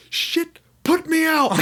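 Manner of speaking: high, loud panicked yell; frantic shout for someone to put him out. Retold or imitated. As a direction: yell for help while burning. On fire, yelling for help.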